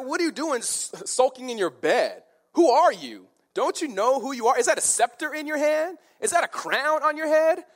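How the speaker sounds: high, loud, incredulous rant; scornful scolding. Acted. Wife berating her sulking king in bed, scornful tirade. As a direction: berate him with rapid-fire rhetorical questions. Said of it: "She just looks at him, pathetic".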